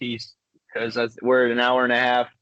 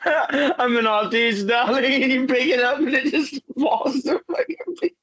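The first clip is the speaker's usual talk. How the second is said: laughing through the quote; 9 semitones higher than usual. Retold or imitated. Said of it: "He's like".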